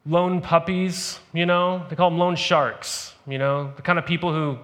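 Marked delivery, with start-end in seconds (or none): 0.1-1.2 drawn out, slow for the joke; comically slow aside; slow joke emphasis. Shown as a demonstration. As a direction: stretch out loan puppies slowly. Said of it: "They don't call 'em loan bunnies".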